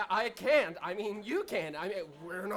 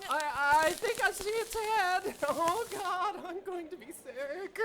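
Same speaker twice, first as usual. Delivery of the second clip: higher, panicked voice breaking into nervous laughs; horrified; 9 semitones higher than usual. Acted. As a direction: recoil in horror at the sight. Horrified onlooker at birth.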